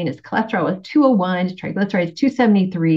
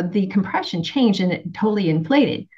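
ordinary delivery

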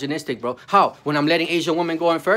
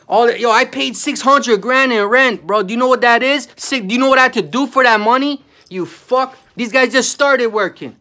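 angry outburst; 5 semitones higher, 5 dB louder than usual. Real, not acted. Venting to viewers about rent paid, real loud angry shout. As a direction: hammer each word, furious about the money.

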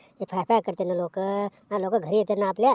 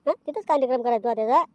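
ordinary talk, nothing marked

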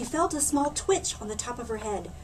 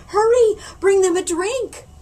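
high, loud urgent command; loud high urgent call; urgent order; 9 semitones higher, 9 dB louder than usual. Acted. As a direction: call for help in a hurry. Principal urgently orders help.

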